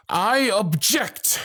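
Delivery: high, slow, ringing cry; bold objection. Acted. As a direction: stop the ceremony with one call. Interrupting the wedding vows.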